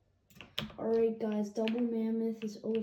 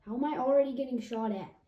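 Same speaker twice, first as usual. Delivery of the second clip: frustrated shout, fast; loud; 3 semitones higher, 9 dB louder, faster than usual. Real, not acted. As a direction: yell it out in disbelief. Repeats the line, first loudly.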